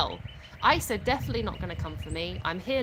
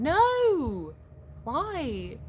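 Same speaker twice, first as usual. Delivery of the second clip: mock dismay; 6 semitones higher than usual. Streamer reacting to a Brit who says mom, in mock dismay. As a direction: playfully cry out as if appalled.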